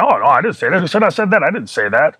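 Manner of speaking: high-pitched mock protest; retold indignant denial. Boss denying the message to the wrestler, high mock protest. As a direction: imitate someone hastily denying it, voice jumping up.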